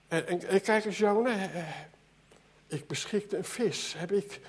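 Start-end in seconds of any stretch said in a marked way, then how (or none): none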